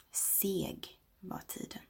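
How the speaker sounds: very slow, breathy, hushed recollection; slow whisper. Real, not acted. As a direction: drag the words like thick time. Slow memory of childhood time. (långsam viskning)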